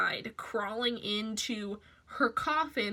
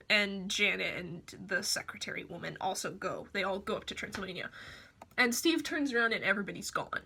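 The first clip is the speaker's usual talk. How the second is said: usual speaking voice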